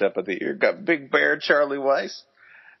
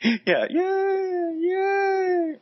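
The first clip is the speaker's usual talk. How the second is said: mock cheering crowd; much slower than usual.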